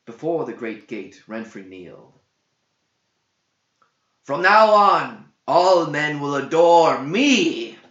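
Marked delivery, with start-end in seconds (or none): 4.2-7.8 loud, high, slow triumphant cry; cried out. A narrator's reading. Kneeling king cries his triumph before the gate, loud and slow. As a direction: cry it out to the heavens, weeping with joy. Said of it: "he cried".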